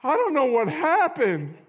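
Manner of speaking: high, slow, laughing put-on voice; a sheepish mock shrug, laughing; laughing mock confusion. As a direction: play dumb through a laugh. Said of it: "and they're like".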